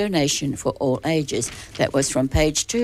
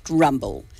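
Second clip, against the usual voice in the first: usual speaking voice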